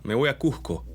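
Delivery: Cusco with a crisp s; Peruvian s. Shown as a demonstration. Teacher demonstrating Cusco with a clear s. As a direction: say Cusco with a full hissed s. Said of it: "la típica palabra".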